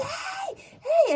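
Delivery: high, held "ay" without extra volume; retold scream. Owner retells yelling at the truck driver, high mock scream. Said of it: "le empecé a gritar al del camión".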